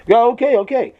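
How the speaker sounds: high, loud hasty agreement; acted panicky compliance; frightened giving in. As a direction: give in with the pitch jumping up. Second guy gives in at gunpoint.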